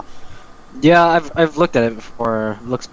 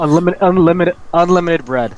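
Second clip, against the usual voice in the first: higher, louder, gleeful repeated pitch; excited joke; 5 semitones higher, 6 dB louder than usual. Pretend. To his friend, pitching Jesus's weapon idea: gleeful excited repetition. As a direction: sell the gag with rising glee. Joking Jesus throws bread.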